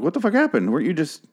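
high-pitched mock outrage; baffled disbelief. Viewer lost after looking away from the show, high baffled exclamation. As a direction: exclaim it in high, incredulous confusion.

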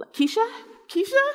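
high, rising, questioning voice; tentative phone caller. Retold or imitated. Office worker on open phone line, tentative calling. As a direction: check timidly whether anyone is listening.

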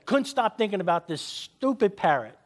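ordinary delivery

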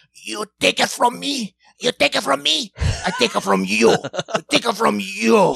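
put-on loud, high shrieking curse like an old Italian woman. Little daughter cursing dad over the Switch in a loud old Italian woman voice. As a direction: yell it as a furious old Italian nonna. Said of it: "she sounds like an old Italian woman".

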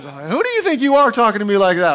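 loud, high-pitched indignant parent; scolding parent. Acted. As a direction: tell off a cheeky child at full voice. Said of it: "if you're a parent".

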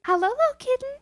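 put-on high-pitched baby talk; sing-song pet voice; cooing to a kitten. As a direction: coax a lost kitten, high and sweet.

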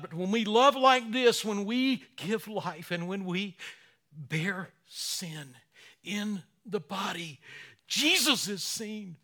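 high, loud, slowing preacher's build; full force preaching. Real, not acted. Pastor preaching to the congregation, at full force. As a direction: drive it home from the pulpit.

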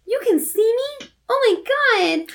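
high, breathless mock wonder; excited ghost girl. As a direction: squeal it like a thrilled, mocked-up ghost.

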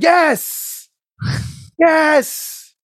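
high, drawn-out cheer; high-pitched yell of agreement; elated yes. Retold or imitated. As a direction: shout yes like your side just scored.

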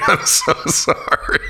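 breathy laugh through the words, slowed; laughing apology. Real, not acted. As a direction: apologize while cracking up. Laughing at own joke.

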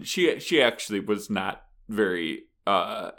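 usual speaking voice

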